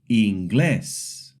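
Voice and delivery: inGLÉS, last syllable strong; inglés with the weight on glés; stress on last syllable. Shown as a demonstration. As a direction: hit the end of inglés. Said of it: "tells us to stress the last syllable".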